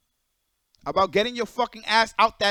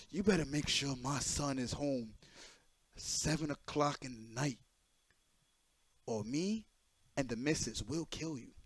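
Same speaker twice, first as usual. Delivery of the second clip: lower, softer, slow parent voice with a threat; stern parent; 6 semitones lower, 14 dB quieter than usual. Retold or imitated. Hikari's father warning the streamer in a lower, slow voice. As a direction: mimic a stern dad warning you off.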